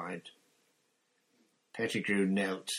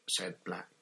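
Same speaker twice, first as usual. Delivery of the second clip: ordinary delivery, nothing marked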